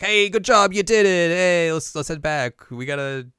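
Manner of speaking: loud, high acted cheer, easing down; hearty congratulations. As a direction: burst in praising the team. Acting a character congratulating the team.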